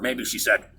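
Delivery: normal talking voice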